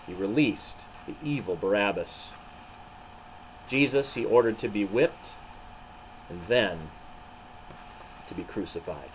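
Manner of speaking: animated storybook reading with voiced figures; story narration. A narrator's reading. Narrator telling children the trial of Jesus, animated. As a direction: read it like a children's Bible story.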